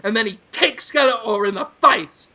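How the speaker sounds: usual speaking voice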